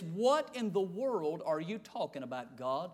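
low, hushed question; puzzled aside to God. Acted. Preacher questioning God as he reads this, low and puzzled. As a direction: drop the voice down and soft, wondering.

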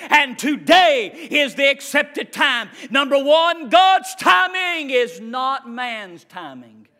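loud, rising revival preaching; full-force preaching. Acted. Preacher urging the church not to rush God, loud preaching. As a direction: preach it hard to the congregation.

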